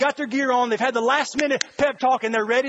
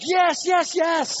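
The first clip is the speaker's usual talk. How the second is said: raised-pitch mock cheering; excited fan; 5 semitones higher, much slower than usual. Middle-aged fan at an eighties concert, high and thrilled. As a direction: chant it like an overexcited fan.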